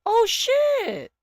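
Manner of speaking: high, drawn-out startled cry; loud, high-pitched shock; surprised shout. Real, not acted. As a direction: burst out as if caught off guard. Spots a friend in chat.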